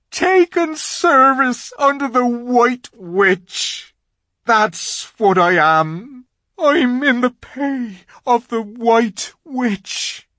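loud, high, slow faun wail; anguished confession. A narrator's reading. Faun admitting to Lucy whom he serves, anguished. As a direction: wail each phrase with heavy drama.

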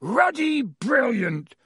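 high, drawn-out booming cheer. A narrator's reading. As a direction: boom it slowly over a crowd. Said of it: "boomed Hagrid over the heads".

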